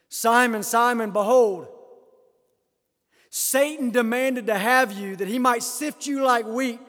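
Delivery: loud, slow, high pulpit warning; forceful scripture proclamation. Acted. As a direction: ring out each phrase to the congregation. Declaims scripture at full force.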